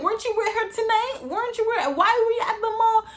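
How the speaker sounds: high, laughing mom voice; mom questioning. Retold or imitated. Mom asks why her daughter was at the mall, high mom voice. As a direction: ask it like a puzzled mom. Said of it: "I was like".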